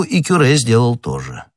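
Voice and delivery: ordinary delivery, nothing marked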